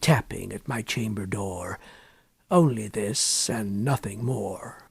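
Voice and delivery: low, soft muttering to himself; muttered. Acted. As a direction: mutter it under your breath. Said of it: "I muttered".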